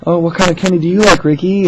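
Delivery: high, loud friendly question; manager humoring a caller. Retold or imitated. Manager asking a regular caller about candy, high chirpy voice. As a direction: sweetly coax the caller. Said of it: "she goes".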